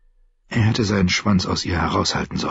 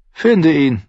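louder, higher short command; firm order; 9 semitones higher, 4 dB louder than usual. Acted. Tyrion to Bronn instead of a kill order, firm louder command. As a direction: give the order with force.

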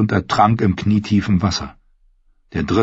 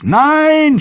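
high, loud desperate scream; 6 dB louder than usual. Acted. Arya screams as the rider circles the wagon, loud desperate scream. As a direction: scream it out in panic. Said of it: "schrie sie".

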